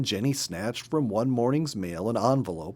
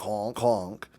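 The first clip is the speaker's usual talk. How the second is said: porcupine honks. Retold or imitated. Imitating porcupine honk.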